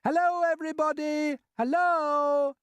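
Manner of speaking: very high, loud hail; joyful calling out. A narrator's reading. Small boy waving to everyone below, calling out happily. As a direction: call down gleefully to the crowd. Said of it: "laughing and waving and calling out".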